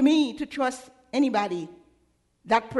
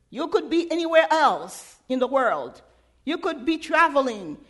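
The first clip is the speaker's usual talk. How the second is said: ordinary delivery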